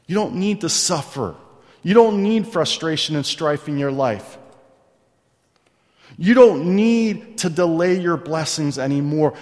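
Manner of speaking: impassioned preacher cadence; preaching. Acted. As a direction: drive the point home from the pulpit. Preaching at full force.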